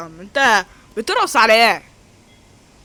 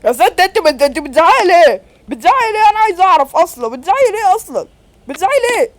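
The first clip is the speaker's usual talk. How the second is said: raised pitch, indignant repeated questioning; exasperated protest; 6 semitones higher than usual. Acted. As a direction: protest back at her, climbing higher as you repeat. Arguing back at the scolding woman. (اعتراض متنرفز بصوت عالي الطبقة)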